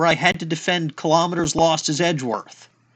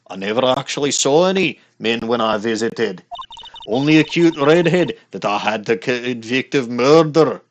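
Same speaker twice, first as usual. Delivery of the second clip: broad hammed-up Scottish brogue; a playful put-on Scottish accent. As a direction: roll it out like a mock Highland laddie. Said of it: "start speaking Scottish".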